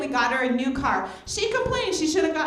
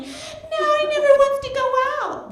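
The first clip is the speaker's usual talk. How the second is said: much higher, whiny mock complaint; 13 semitones higher, 3 dB louder than usual. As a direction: whine like the friend. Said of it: "she starts complaining".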